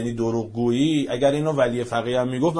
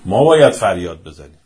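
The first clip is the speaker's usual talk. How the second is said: a louder rallying cry, slowing down; loud call; 3 semitones lower, 8 dB louder than usual. Real, not acted. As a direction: call it out to the whole crowd. Preacher calling out to supporters.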